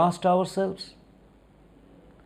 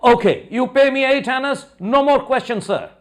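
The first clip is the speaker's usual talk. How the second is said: raised, higher pitched angry retort; angry boatman; 8 semitones higher than usual. A narrator's reading. Boatman angrily accepting eight annas, raised angry voice. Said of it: "The boatman got very angry".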